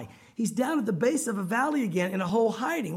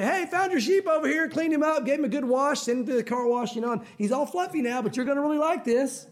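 higher, eager show-off; mock boasting; 4 semitones higher than usual. Showing off to the shepherd, mock boasting. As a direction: play an eager helper fishing for praise.

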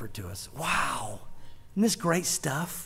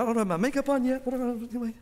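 flustered bride; 5 semitones higher, faster than usual. Retold or imitated. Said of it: "it's like".

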